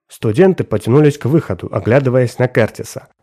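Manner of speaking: ordinary delivery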